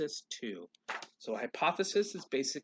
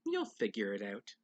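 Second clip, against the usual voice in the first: soft whisper; 6 semitones lower, 7 dB quieter than usual. Acted. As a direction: lower and softer, hushed goodnight. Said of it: "They kissed her and whispered".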